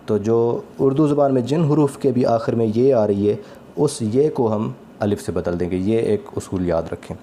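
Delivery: normal talking voice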